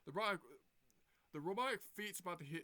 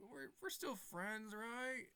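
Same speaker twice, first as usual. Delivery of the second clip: high, slow wheedling plea; pleading villain; 7 semitones higher, much slower than usual. Retold or imitated. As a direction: wheedle like a cornered cartoon villain.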